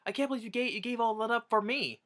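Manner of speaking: high, incredulous voice; amazed hero. Retold or imitated. Rescued lover in the lifeboat, high and mock-incredulous. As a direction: marvel at her sacrifice, mock-amazed.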